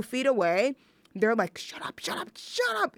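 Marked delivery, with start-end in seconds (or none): 1.6-2.9 urgent whispered hush rising to a sharp squeak; hushed shut up. Retold or imitated. Siblings hushing her so mom stays asleep, urgent whisper. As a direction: hiss it like kids who don't want mom woken.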